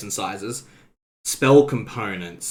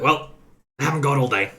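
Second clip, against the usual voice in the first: impatient grumpy man; 4 semitones higher, 4 dB louder, faster than usual. Acted. Impatient NPC through door.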